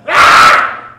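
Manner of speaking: voiceless, sudden loud screech; demon shriek. Retold or imitated. Preacher imagining the impure spirit leaving, loud voiceless shriek. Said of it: "I wonder how that thing sounded".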